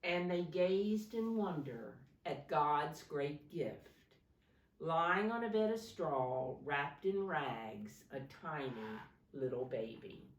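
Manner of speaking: put-on dramatic read-aloud for kids; storybook reading. A narrator's reading. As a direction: read the Christmas story to little ones with energy. Reading picture book to children.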